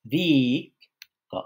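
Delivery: "ভিক ক" with a loud, drawn-out "ভিক", then a faint "ক"; first-syllable stress. Shown as a demonstration. Teacher giving another example of the drop, strong first syllable in "ভিক ক". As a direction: punch "ভিক", pause, drop "ক". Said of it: "ঠিক এরকম".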